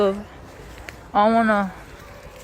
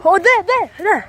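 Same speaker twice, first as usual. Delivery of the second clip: excited; 10 semitones higher than usual. Real, not acted. Chasing the cat, calling out.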